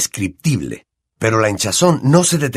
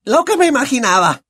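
high, brash, boozy aunt voice; Aunt Marge. Acted. Aunt sneers at the family over brandy, high brash voice.

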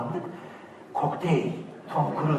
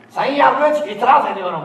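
pompous courtroom objection, higher and louder; mock lawyer; 6 semitones higher, 5 dB louder than usual. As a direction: play a movie lawyer objecting. (sahte avukat edasıyla itiraz)

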